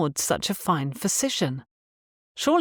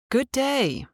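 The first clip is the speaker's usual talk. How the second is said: put-on a higher, very proper English accent greeting; 9 semitones higher than usual. Doctor greeting the patient in a proper English accent. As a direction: greet her like a refined English gentleman. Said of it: "in an accent even more proper than Uncle's".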